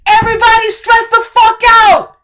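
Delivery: high, loud yelled retort; husband shouting over her tears. Acted. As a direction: bellow it back at her. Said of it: "Phil shouts".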